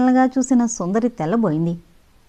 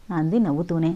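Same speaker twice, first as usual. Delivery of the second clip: ordinary delivery, nothing marked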